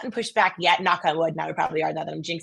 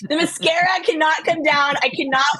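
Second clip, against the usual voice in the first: higher, louder, comic desperation; mock panic; 6 semitones higher, 4 dB louder than usual. Fighting tears in full makeup, comically panicked. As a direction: protest as if holding back tears.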